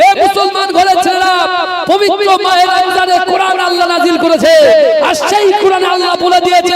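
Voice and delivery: high, loud, ringing sermon cry; full-force preaching. Acted. Preacher rousing the young men of the village, full-force sermon shout. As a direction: thunder it out to the whole hall.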